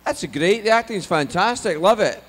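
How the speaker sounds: loud, high, delighted Scottish father; Scottish dad suddenly won over, hammed-up; thick Scottish accent. Dad approving once money is told, gushing Scottish. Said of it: "my parents have a very thick Scottish accent".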